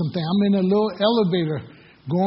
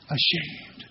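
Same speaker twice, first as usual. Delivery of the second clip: breathy whispered answer; just breathe the word out; a soft hushed ashamed; 8 dB quieter than usual. Acted. Congregation prompted to finish verse.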